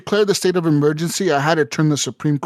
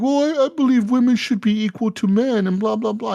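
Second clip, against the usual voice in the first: higher mock wife voice, prim and earnest; imitating his wife; 7 semitones higher than usual. Wife pitching her speech, a higher mock voice. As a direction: play the wife lightly, pitched up. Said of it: "And she's like".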